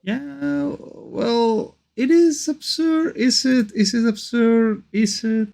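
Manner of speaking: high squeaky cute voice; cute anime streamer. Acted. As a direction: pitch way up, cutesy and teasing. Mock-questioning the video's claim.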